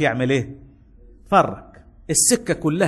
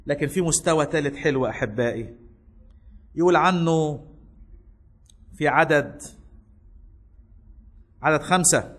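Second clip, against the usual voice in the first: ordinary talk, nothing marked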